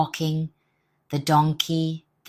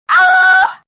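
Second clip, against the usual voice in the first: louder breathy yell of pain; yelp; 13 dB louder than usual. Acted. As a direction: cry out at the bite. Dog bites robber.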